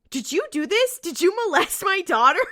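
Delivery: high-pitched, hammed-up accusation; playful mock outrage; mock accusing dad. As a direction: imitate an outraged father demanding answers. Acting out dad accusing the boys.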